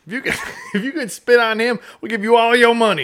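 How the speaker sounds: high, loud laughing pitchman; mock ad pitch. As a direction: shout the offer like a cheesy ad. Joining the mock casino ad.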